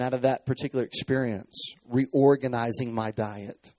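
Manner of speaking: ordinary talk, nothing marked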